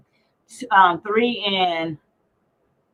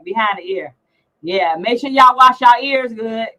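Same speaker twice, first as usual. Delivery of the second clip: usual speaking voice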